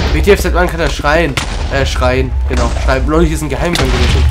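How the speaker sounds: loud, startled exclaiming; loud alarm. Real, not acted. Player amazed the attacker can scream, loud alarm. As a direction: raise your voice in disbelief.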